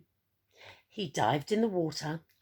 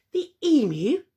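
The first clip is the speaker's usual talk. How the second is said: higher, louder startled emu; gasped surprise; 7 semitones higher, 7 dB louder than usual. Acted. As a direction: caught off guard, disbelieving. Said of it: "gasped Edward".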